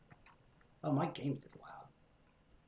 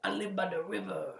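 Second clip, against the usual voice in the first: exaggerated, higher South American accent; mock a villain's South American accent; hammed-up South American accent line; bad South American accent; 6 semitones higher than usual. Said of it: "a very offensive South American accent".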